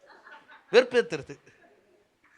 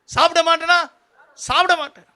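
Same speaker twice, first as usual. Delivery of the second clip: high-pitched mock obstinacy; hammed-up, defiant refusal; stubborn refusal; 9 semitones higher, 9 dB louder than usual. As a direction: play someone refusing out of pride. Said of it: "வைராக்கியம்".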